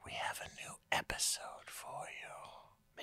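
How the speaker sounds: slow, breathy whispered elf voice; sexy elf whisper. Pretend. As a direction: purr it like a seductive elf. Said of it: "We have sexy elf voices".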